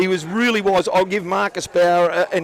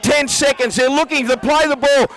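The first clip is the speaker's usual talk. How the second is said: high, excited, shouted final-seconds call; live play-by-play; 11 semitones higher, slower than usual. Real, not acted. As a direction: call the last seconds at full voice.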